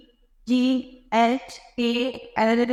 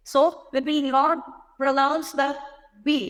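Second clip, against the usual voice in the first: slow explanation; much slower than usual. Shown as a demonstration. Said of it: "बी silent है".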